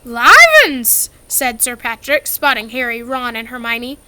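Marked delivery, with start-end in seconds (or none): 0.0-1.1 fake astonishment. A narrator's reading. Mock astonishment.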